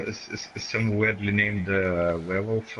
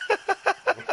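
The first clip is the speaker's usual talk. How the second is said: laughing; 6 semitones higher than usual. Real, not acted.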